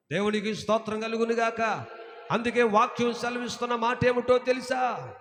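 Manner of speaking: full-force preaching. Acted. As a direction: proclaim it from the pulpit. (గట్టిగా బోధించే స్వరం)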